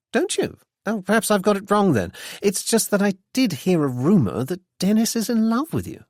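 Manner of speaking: mock-innocent, teasing insinuation; pointed insinuation. A narrator's reading. Freddy hinting to Anne about Dennis, sly and teasing. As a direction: needle her with a sly question. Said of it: "no mistaking his tone".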